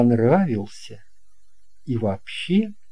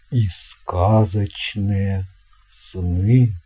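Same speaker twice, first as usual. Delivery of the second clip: lower, slow, grand sea captain; captain's voice; 5 semitones lower, 3 dB louder, slower than usual. Acted. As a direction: slowly talk up the best cabin with a wink. Captain shows lifeboat. (неторопливый низкий голос капитана)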